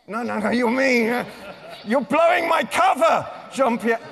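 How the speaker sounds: loud, high hammed-up protest; loud mock protest at a friend; mock outrage. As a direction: play at being exposed, loud and high. Mock outrage at blown cover.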